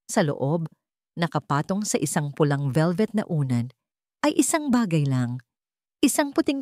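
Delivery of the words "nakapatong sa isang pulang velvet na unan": usual speaking voice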